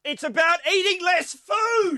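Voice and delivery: high, slow, loud outburst; exasperated shout. Real, not acted. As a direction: blurt out the obvious answer. Mocking long-winded food lobby quote.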